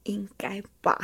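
unvoiced whispered reply. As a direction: answer back in a barely audible whisper. Whispered reply across meeting table. (悄声回答)

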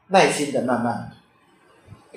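慢慢地、轻声地读; slow and soft. Shown as a demonstration. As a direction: ease the words out slowly, as if showing how to read.

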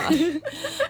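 high rising laugh; a sudden bright laugh; burst of laughter. Real, not acted. Laughs at friend's joke.

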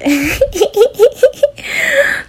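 high burst of laughter; loud laugh. Real, not acted. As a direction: crack up at yourself. Laughs at her own silly line.

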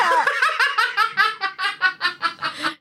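high-pitched real laughing fit; long laugh. Real, not acted. Storyteller amused by her own scolding of friend, long laugh.

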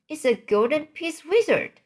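high-pitched, delighted exclamation; excited cry. A narrator's reading. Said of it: "he cried".

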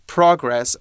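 "progress" as PRAH-gress, first syllable stressed; noun stress, American vowel. Shown as a demonstration. Teacher confirms the noun form, stressed PRAH-gress. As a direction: stress the start of "progress" with an open ah. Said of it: "we put the emphasis at the beginning".

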